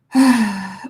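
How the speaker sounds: heavy, audible exhale; exasperated huff of breath; loud sigh. Real, not acted. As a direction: let out a big weary sigh. Exasperated at dog licking again.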